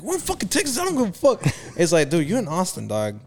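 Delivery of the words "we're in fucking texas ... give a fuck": high, cocky mock swagger; mocking bravado. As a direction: parody the loudmouth's defiance. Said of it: "He's like".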